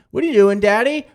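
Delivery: put-on high, loud kid voice asking Daddy; mock child asking what are you doing; child's question. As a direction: play the curious little kid. Dad voicing his kid's question.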